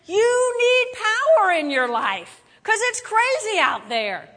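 Jesus speaking with big preacher force; voicing Jesus. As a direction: proclaim it slowly as Jesus would. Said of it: "and he says".

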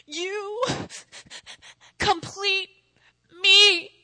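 high, hammed-up movie love declaration; milk each word with pauses, building to a loud "me"; Jerry Maguire. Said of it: "he's like".

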